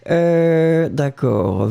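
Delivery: ordinary talk, nothing marked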